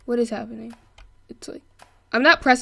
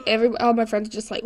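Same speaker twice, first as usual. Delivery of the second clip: usual speaking voice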